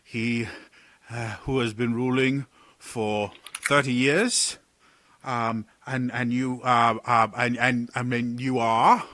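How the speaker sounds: a rattled courtier tripping over his words; flustered advisor Sidon. Acted. Sidon nervously introduces himself to the party, stammering.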